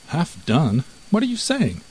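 high-pitched disbelief, rising on the question; incredulous. A narrator's reading. Mouse incredulous at the name.

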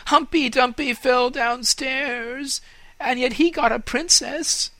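high, loud, hopeful tree voice; hopeful fir tree. Acted. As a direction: cry out the hope. Tree hopes aloud.

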